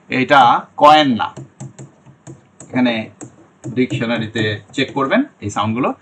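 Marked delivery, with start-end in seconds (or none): none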